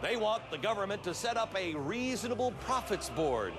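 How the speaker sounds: grandiose party propagandist addressing comrades; hammed-up comrade orator reporting from the front; mock Soviet comrade. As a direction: deliver a mock state broadcast, rousing and theatrical.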